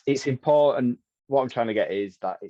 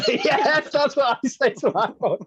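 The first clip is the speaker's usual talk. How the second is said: high, loud laughing agreement; 6 dB louder, slower than usual. Real, not acted. Host laughing along at a guest's story about his dad. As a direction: laugh it out, recognising yourself.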